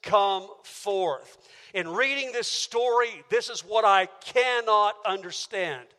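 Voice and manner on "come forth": ordinary talk, nothing marked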